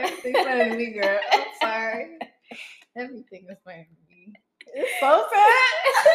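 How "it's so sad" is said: high, loud, drawn out through laughter; laughing despite herself. Real, not acted. Listener who can't help finding friend's covid story funny, laughing. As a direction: crack up on the words, helplessly. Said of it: "Oh, I don't mean to laugh".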